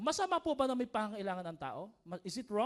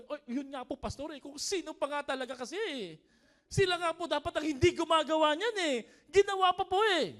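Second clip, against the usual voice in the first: high, loud protest to the pastor; aggrieved churchgoer pushing the blame away; indignant complaint; 8 semitones higher, 7 dB louder than usual. Acted. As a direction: act out an exasperated complaint, rising in pitch and volume. Acted protest of someone refusing to overlook.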